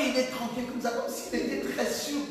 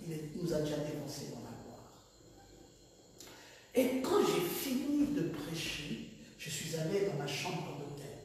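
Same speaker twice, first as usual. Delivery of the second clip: normal talking voice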